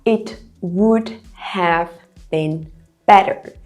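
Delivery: slow, separated words for learners; careful dictation-style delivery. Shown as a demonstration. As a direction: break the sentence down slowly, pausing between words. Teacher breaks the sentence down word by word.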